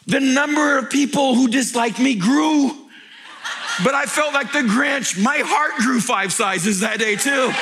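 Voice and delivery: high, loud, driving sermon; full-force preaching. Acted. Pastor firing up the congregation, loud preaching. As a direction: preach it out to the crowd.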